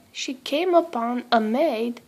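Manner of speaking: ordinary delivery, nothing marked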